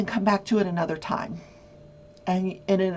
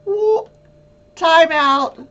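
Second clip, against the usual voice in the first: high, loud mock-shocked time out; calling a halt in mock panic, high and loud; mock alarm; 9 semitones higher, 8 dB louder, much slower than usual.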